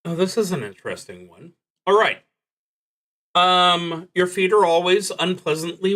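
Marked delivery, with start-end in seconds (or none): none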